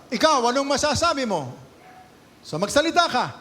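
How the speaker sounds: high, loud, pushy challenge; demanding accusers. Acted. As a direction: corner him and force an answer. Accusers pressing Jesus to speak.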